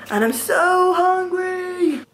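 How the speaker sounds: high, slow and drawn out; exaggerated hungry whine; whiny hungry. Real, not acted. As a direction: stretch out the so, pleading with hunger. Whining about hunger while cooking.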